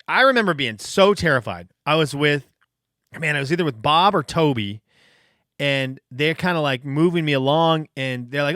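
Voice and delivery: ordinary delivery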